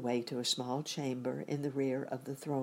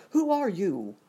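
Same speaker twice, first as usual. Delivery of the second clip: loud, raised challenge; crying out; 6 semitones higher, 8 dB louder than usual. A narrator's reading. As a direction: cry it out at an intruder. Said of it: "cried out".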